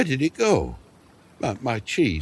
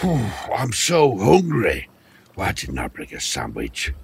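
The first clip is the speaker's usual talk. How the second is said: weary hungry sigh. Acted. Starving old man sighs.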